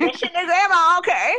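put-on high, loud old granny voice; grandma voice. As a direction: sound like a bossy old grandma. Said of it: "a really good grandma voice".

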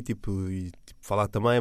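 ordinary talk, nothing marked

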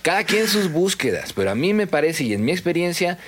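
ordinary talk

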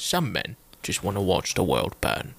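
put-on slow, low over-the-top Cockney accent; Cockney Michael Caine. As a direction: drag each word out, deep Cockney. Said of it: "It's a very Cockney accent".

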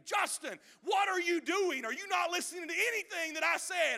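loud, high furious yell; guide's scream. Retold or imitated. Fishing guide screaming at the preacher, loud and high. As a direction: bark it like an angry fishing guide. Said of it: "he screams at me".

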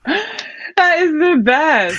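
high, loud and slow, drawn out in delight. Real, not acted. Singer reacting to the interviewer's Nuh-Uh story, high loud delight. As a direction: gush it, thrilled.

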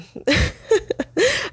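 high-pitched laugh. Real, not acted. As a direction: burst of high laughter at your own story. Laughs at own story.

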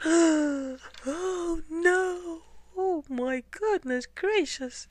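high wailing fright; terrified cry. Acted. Hunter scared of himself, high wailing cry. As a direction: wail in mock terror.

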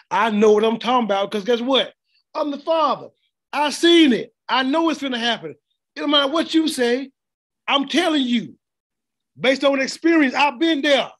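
high, loud fatherly insistence; insistent father. Acted. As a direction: lay down the law as a father who knows. Voicing an insistent father to children.

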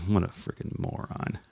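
imitating a scornful whisper; Jim's silent judgment, whispered; unspoken scorn. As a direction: mouth it with contempt, barely voiced. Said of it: "you didn't say that out loud".

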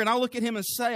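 normal talking voice

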